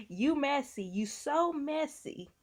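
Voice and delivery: high, drawn-out mock scolding; Tisha. Acting out the wife calling her husband messy, teasing mock scold. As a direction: playfully imitate a wife telling off her husband. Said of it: "I'ma be like Tisha".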